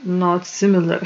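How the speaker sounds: usual speaking voice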